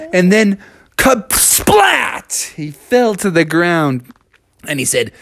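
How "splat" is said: high, explosive splat; comic splattering crash; crash sound effect. Acted. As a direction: burst out a cartoon impact. Imitates the frog crashing.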